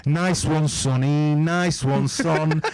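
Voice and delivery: a hammed-up Liverpool Scouse voice; Scouse accent. Shown as a demonstration. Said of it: "Now you gotta do the Scouse accent".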